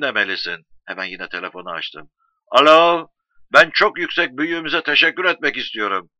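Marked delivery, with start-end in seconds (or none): 2.5-6.1 loud, raised hello, then formal gratitude; eager phone call. Acted. Grateful man phoning to thank his great leader, eager and loud. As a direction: project down the line, full of deference. Calls out into telephone again.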